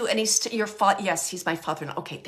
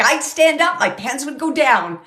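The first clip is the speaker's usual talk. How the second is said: loud, pitched-up comic retelling; humorous quote; 4 semitones higher, 9 dB louder than usual. Retold or imitated. Medium passing on his funny remark, loud and jokey. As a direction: punch out the joke in his place. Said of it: "He's saying funny things like that".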